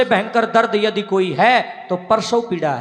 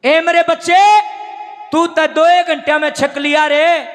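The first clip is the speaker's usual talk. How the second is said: emotional raised call; 6 semitones higher, 3 dB louder than usual. Acted. Mother scolds son, emotional.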